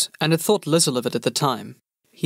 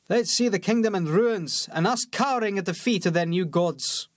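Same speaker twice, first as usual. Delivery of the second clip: higher, softer warrior voice, scoffing; scornful soldier; 5 semitones higher, 3 dB quieter than usual. A narrator's reading. Bellis mocking the pauper prince, scornful soldier voice. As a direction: sneer at the rebels.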